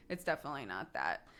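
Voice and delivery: soft, low, sober restatement; subdued denial. Real, not acted. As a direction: say it quietly, without laughing. Same denial repeated after the laugh.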